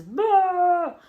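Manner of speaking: high, loud drawn-out bruh; exasperated bruh. Retold or imitated. Solo in the corner, a high, loud mock bruh. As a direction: imitate a fed-up wrestler. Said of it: "He does his".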